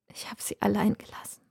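put-on the mother whispers to her old friend. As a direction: whisper it as a confession. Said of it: "flüstert sie".

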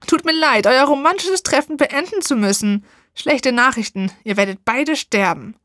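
a sneering witch spoiling the romance; wicked witch, mocking. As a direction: witch mocking the couple before threatening them. Witch interrupts the lovers.

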